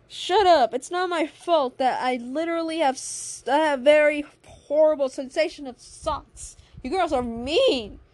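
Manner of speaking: loud, wounded outburst; defensive, worked-up acted whine; almost emotional. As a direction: protest with hammed-up hurt. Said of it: "in a very almost, almost of an emotional".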